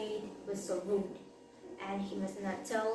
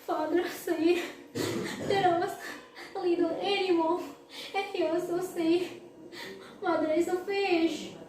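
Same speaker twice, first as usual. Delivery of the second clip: put-on upset child; 9 semitones higher, 4 dB louder than usual. Child reports to mother.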